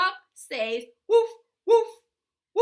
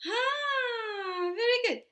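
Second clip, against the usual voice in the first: high, delighted praise; excited praise; 8 semitones higher than usual. Real, not acted. Praising child.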